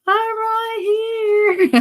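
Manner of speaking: very high, very slow little-voice answer; high slow tiny voice of Siri. Retold or imitated. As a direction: play the phone sweetly piping back from nearby.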